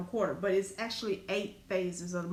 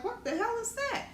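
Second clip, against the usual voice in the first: sudden high, shocked question; startled discovery; 12 semitones higher than usual. Retold or imitated. Finding marks on her leg in the shower, shocked high question. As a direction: jump up high as you spot the strange marks. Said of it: "I'm like".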